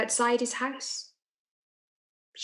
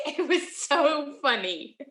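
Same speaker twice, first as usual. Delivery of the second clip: slow words broken by giggles; laughing gleefully; 3 semitones higher, slower than usual. Acted. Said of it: "it was my turn to start laughing".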